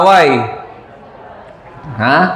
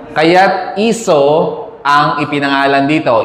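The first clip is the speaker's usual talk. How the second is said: normal talking voice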